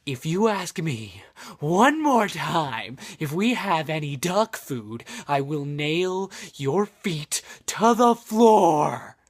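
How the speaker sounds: slow threat building to loud; fed-up manager hammering out each word; angry threat. Acted.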